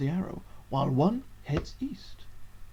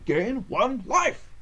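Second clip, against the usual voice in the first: slow grand announcement; 6 semitones higher, much slower than usual. Acted. Reading card out dramatically.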